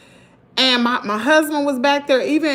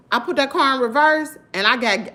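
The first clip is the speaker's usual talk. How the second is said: ordinary talk, nothing marked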